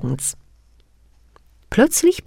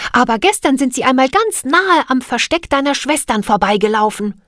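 put-on raised, higher eager girl; young girl's voice; 7 semitones higher than usual. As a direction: speak up like an eager little girl. Mona voiced in story.

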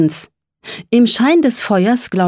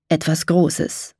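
ordinary delivery, nothing marked

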